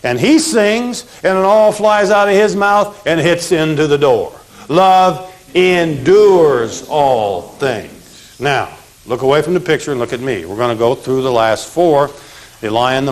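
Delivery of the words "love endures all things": slow recitation. Shown as a demonstration. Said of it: "chanted memory phrase".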